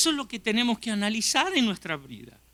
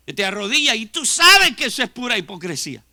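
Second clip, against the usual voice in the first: louder, accusing hypocrisy; stern preaching; 3 semitones higher, 9 dB louder than usual. Acted. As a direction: call out the hypocrite.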